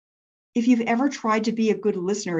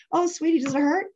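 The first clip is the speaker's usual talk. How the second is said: higher, sympathetic coo; soothing mom; 7 semitones higher than usual. Retold or imitated. Mom answering her hurt daughter, tender concern. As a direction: gently echo a child's hurt in a raised motherly voice.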